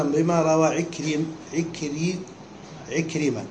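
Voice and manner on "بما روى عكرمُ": "عكرم" ends on the mim, ta dropped; truncated name ending in -mu. Shown as a demonstration. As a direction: slowly read "بما روى عكرم" with the ta cut off. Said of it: "ويقول عكرم مثلًا هنا".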